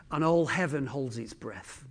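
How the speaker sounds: hushed narration. A narrator's reading.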